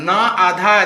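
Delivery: ordinary talk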